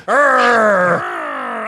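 loud pirate arr; pirate growl. Pretend. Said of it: "I'm defensive".

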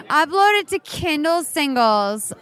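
usual speaking voice

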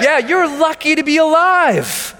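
high, slow, stretched-out holler; rousing motivational yell; rallying shout. Real, not acted. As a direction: shout it to the whole room, drawing it out. Rallying the crowd.

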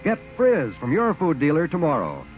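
ordinary delivery

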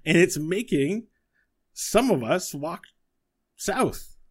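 high, eager inventor voice; high professorial little voice; Buttons theorizing. Acted. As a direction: explain the theory excitedly. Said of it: "And he says".

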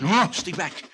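high, loud warning; frantic shout. Acted. Foreman waving the gun at Buster, frantic shout. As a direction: shout them back.

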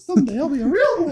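high put-on squeaky voice; silly high voice. Joining friends' silly voices, silly high voice. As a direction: say it in a mock tiny high voice.

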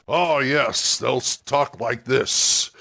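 stock gruff tavern-keeper voice, drawn out; slow, put-on barkeep voice. Shown as a demonstration. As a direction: play a standard gruff fantasy barkeep. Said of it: "and they'll sound gruff".

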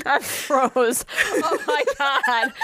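high, slow words through laughter; real helpless laughter. Real, not acted. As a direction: crack up while talking. Cracks up at co-host's joke.

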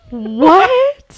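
baffled high what; incredulous. Acted. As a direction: react in total disbelief. Incredulous reaction to teammates.

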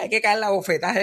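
ordinary delivery

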